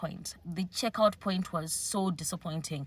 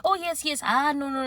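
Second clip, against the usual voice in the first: friendly excited; 7 semitones higher, 4 dB louder than usual. Retold or imitated. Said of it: "very friendly and was like".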